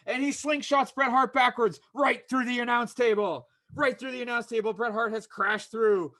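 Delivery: lively ringside commentary, calling every move; wrestling play-by-play. Acted. Host calling his own wrestling show, energetic play-by-play. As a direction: call the match like a TV announcer.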